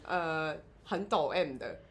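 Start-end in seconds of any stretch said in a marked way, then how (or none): none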